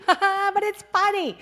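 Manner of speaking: high, loud giggly retort; laughing it off. Retold or imitated. To her boss, laughing high and loud. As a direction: brush off the bad news with a laugh. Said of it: "And I'm like".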